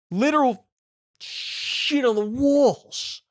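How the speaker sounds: high, drawn-out exclamation of disgusted disbelief; appalled. Real, not acted. Reactor describing the mess on the walls, appalled.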